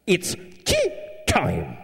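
high, prim English lady voice; high-pitched mock scold, sit down and shut up; prim director. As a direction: mimic the proper director scolding. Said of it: "she interrupted me, and she said".

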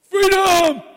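high, loud Braveheart roar; rally the crowd with a yell; battle cry shout. Retold or imitated. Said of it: "all you would have to do is go".

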